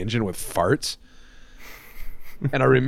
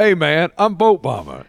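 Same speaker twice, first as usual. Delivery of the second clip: higher-pitched mimicked accent; imitating Boat Bomber's accent; 5 semitones higher, 4 dB louder than usual. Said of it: "it's hard to do your accent".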